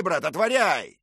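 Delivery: insist, hammering it home; shouted command. Acted. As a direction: knight repeating his order. Knight shouting at door.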